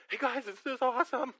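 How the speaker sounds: a playful impression of someone overwhelmed; lightly hammed-up amazed worshipper; mock awed call. Mock voice lying face down before God.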